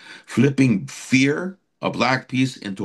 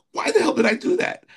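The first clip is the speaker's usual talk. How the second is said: incredulous self-question; faster than usual. Retold or imitated. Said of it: "And I'm like".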